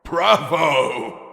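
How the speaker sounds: loud, laughing cheer; loud delighted bravo. A narrator's reading. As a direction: roar it out in delight. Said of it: "laughing uproariously".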